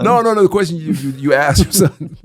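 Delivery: passionate denial. Real, not acted. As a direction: jump in loud and high to deny it. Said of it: "I'm passionate right now".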